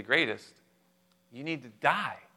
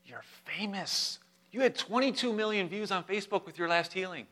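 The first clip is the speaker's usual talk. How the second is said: higher, softer mock pleading; mock rebuke; 6 semitones higher, 6 dB quieter than usual. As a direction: reason with him in an acted, hushed aside. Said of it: "He began to rebuke Jesus".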